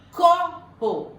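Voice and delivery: "Copo" high and loud on CO, then a separate po; "Copo" split into two beats, first one much stronger; stress on CO. Shown as a demonstration. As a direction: punch CO in "Copo", then pause. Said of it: "Qual que é a sílaba tônica?".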